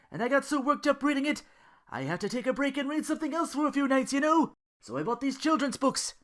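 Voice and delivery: put-on excited young man raving to his lady; eager Duke. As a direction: gush about children's books. Duke excited then Belle.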